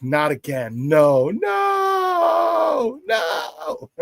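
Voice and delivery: high, cracking cry of no; a fan's anguished no, no, no. Retold or imitated. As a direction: relive the dread of the blocked kick. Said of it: "I was like".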